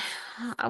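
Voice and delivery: long weary unvoiced sigh; tired sigh. Real, not acted. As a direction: let out a worn-out breath. Tired teacher crafting on stream.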